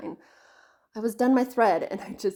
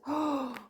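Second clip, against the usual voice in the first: sharp gasp; 6 semitones higher than usual. Real, not acted. Remembers something mid-talk.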